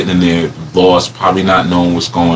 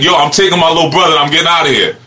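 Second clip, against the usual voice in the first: higher, fast, defiant; tough little kid; 8 semitones higher, faster than usual. Retold or imitated. Boy with a stick telling off the cop, fast and defiant. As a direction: rattle it off like a fierce kid. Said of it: "I had the stick like".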